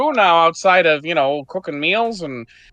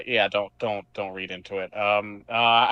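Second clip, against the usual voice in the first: embarrassed mumble; 11 semitones lower, 7 dB quieter than usual. Acted. Fred embarrassed, brushing it off.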